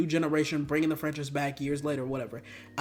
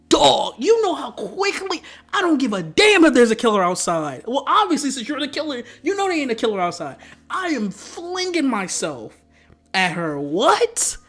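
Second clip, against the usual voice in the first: loud, high, emphatic ranting; hammed-up eagerness; 6 semitones higher, 6 dB louder than usual. Vlogger imagining himself in Charlie's place, loud hammed-up eagerness. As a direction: rant it big, as if outraged anyone would hesitate.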